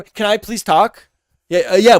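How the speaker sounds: normal talking voice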